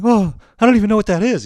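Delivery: loud, high-pitched protest; flustered bafflement. Retold or imitated. Freshman given a crude nickname, flustered bafflement. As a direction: blurt out comic cluelessness.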